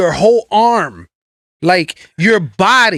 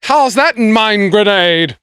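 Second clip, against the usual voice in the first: mock British accent; 4 semitones higher than usual. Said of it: "British accent somehow".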